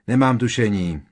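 mildly grumpy, loud reply; grumbling. A narrator's reading. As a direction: grumble it stubbornly. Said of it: "zabručel paličák Ron". (mírně nabručeně)

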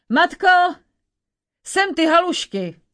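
hungry giant bellowing at his mother; giant's call. Acted. Giant shouting to his mother for dumplings, booming call.

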